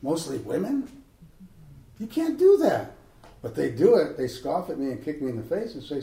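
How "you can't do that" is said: mock protest. Protest at statue removal.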